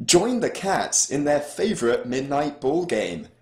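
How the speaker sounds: high-pitched, hyped app store blurb; all-caps ad read. Acted. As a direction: build to a slow, drawn-out title. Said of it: "then Cat Physics in all caps".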